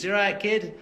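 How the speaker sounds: high-pitched Leeds greeting; Leeds accent. Shown as a demonstration. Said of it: "one of my boys is from Leeds".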